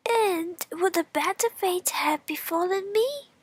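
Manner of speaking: high, far-off straw voice; straw character. Acted. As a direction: squeak a bitter question as the straw. Straw voiced in fairy tale.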